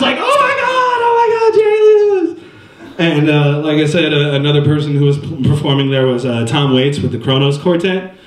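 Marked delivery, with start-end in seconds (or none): 0.2-2.4 much higher, fast, squealing fan gush; mock swooning girls in the crowd; screaming fan. Crowd going crazy at wink.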